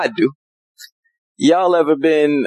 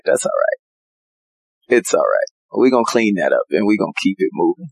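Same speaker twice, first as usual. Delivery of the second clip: gentle reassuring God; 5 semitones lower than usual. Retold or imitated. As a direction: comfort a sorry child like a loving father. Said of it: "And he's gonna be like".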